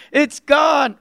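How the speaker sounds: announcer shout. Shown as a demonstration. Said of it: "I like the parrot voice one".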